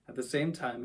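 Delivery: usual speaking voice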